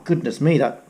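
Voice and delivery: ordinary delivery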